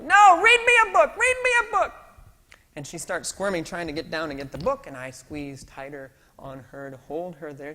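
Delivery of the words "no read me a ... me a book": put-on much higher, louder child whine; whining toddler demand. Daughter begging dad for a book, high child whine. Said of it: "She changes her screaming".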